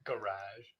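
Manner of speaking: GARAGE stressed on the second syllable; American pronunciation. Shown as a demonstration. Prompting with the word, GARAGE quietly as "guh-RAHZH". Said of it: "another one that I heard him say".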